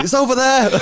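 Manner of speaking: high, loud mock call; excited discovery. Joking with friend about a metal detector, excited call. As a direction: call out a comic find, laughing. Voices the detector's eager find.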